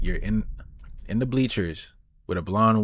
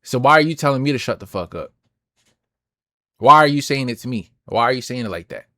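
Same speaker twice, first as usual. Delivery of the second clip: indignant, louder challenge; confrontational questioning; 3 semitones higher, 7 dB louder than usual. Acted. Fan confronting someone who told him to shut up, indignant. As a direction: demand an explanation, voice pushed up. Imagined confrontation over a rude remark.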